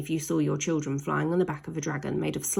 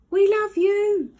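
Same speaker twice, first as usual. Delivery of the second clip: high, loud, slow call of adoration; shouting up; 13 semitones higher, 8 dB louder, slower than usual. Acted. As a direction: yell it up to the dragon. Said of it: "they shouted up".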